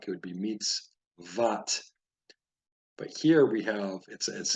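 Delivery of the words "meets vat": "meets vat" slowly, split into two syllables; hypothetical vowel. Shown as a demonstration. Teacher showing another vowel under the vav, syllable by syllable. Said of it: "Let's say it was a patak".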